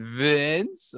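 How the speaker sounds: drawn-out name with rising end, mimicking the host; imitating host's intro. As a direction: mimic an announcer stretching out the name. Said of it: "I chuckled after you said".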